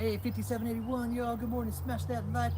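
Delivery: high, loud streamer hello; hyped greeting. Real, not acted. Vlogger greeting his live chat, loud and high. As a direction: pump up the viewers.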